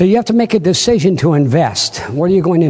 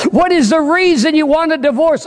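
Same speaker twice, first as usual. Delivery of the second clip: higher, insistent judge demanding a straight reason; exasperated judge; 10 semitones higher than usual. Retold or imitated. Judge demanding the woman's reason for divorce, exasperated. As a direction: press hard for an answer. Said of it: "said the exasperated judge".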